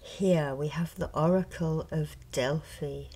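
ordinary talk, nothing marked

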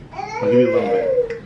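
very high, squeaky teasing voice. Acted. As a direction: repeat it in a tiny squeak. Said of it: "repeats it squeakily".

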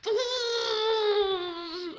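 long high whine sliding down; blender whir. Acted. Sticking him in the blender, high whirring imitation. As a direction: mimic a motor at high pitch. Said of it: "sticks him in the blender".

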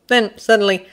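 usual speaking voice

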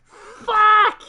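a high, loud, cracking cry of rage; furious shout. Real, not acted. Streamer realizing he simmed the wrong series, furious shout. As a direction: scream it at the screen in real despair.

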